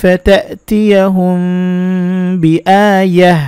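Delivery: slow melodic tajweed recitation; Quran recitation. Acted. Teacher reciting the verse in full for viewers, slow melodic recitation. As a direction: chant each phrase long and measured.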